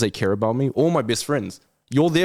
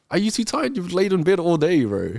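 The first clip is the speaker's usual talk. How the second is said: incredulous; 9 semitones higher than usual. Real, not acted. Scoffing at lazy excuses.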